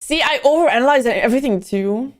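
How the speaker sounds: normal talking voice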